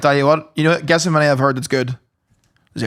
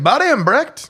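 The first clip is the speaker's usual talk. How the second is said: high-pitched mock Canadian voice; Canadian accent; 9 semitones higher than usual. Retelling what a Canadian friend said, mock Canadian accent. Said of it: "I never noticed the Canadian accent".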